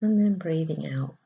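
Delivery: soft, hushed meditation voice with long pauses; guided meditation. Acted. Spiritual coach guiding listeners to breathe into the heart, soft meditation voice. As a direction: guide them gently through each breath, softly and unhurriedly.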